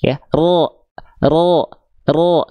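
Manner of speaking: "ro" dibaca tebal seperti o. Shown as a demonstration. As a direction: ulangi "ro" dengan pangkal lidah naik. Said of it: "cenderung seperti vokal O". (contoh "ro" tebal)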